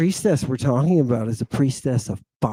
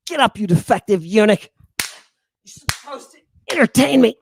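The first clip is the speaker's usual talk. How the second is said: higher, faster spoiled-king scolding; mock royal command; 7 semitones higher, faster than usual. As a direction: bark like a pampered ruler at his servant.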